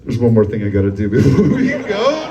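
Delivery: a low, loud leering killer. Retold or imitated. Murderer before leaving the scene, in a low leering voice. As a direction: drop low and leer. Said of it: "you're like".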